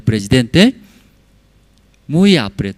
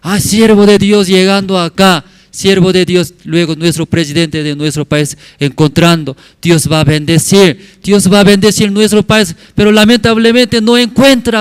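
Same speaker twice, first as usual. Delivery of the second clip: high, loud pleading without real sobs; retold impassioned lament, pitched up; imitated tearful plea; 8 semitones higher, 7 dB louder than usual. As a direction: plead at full voice, acting out a weeping caller. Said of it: "ahora estoy llorando".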